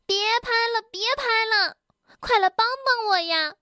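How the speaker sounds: high-pitched cry for help. Acted. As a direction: call out urgently, pitched high. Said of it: "蹦蹦大叫道". (高声急切呼救)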